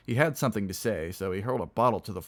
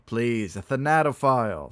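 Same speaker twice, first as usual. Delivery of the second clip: loud, raised-pitch protest; reproachful; 4 semitones higher, 7 dB louder than usual. Acted. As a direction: correct him with mock offence.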